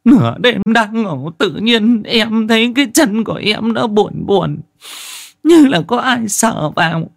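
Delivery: high, loud, panicked female voice; frightened woman's telling. Acted. Wife telling the crew what she saw, terrified and trembling. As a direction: pour out the horror still shaking. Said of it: "chị Giang vẫn cứ run rẩy kể".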